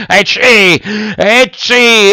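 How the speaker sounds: two high theatrical sneezes; big high mock "etciù"; acted sneezes. A narrator's reading. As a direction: sneeze loudly in character, twice. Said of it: "fece altri due starnuti".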